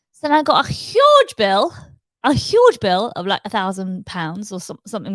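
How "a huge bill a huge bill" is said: "huge" stretched, high and loud; stress on huge. Real, not acted. Telling viewers about the shock bill, stress on huge. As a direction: lean hard and slow on "huge".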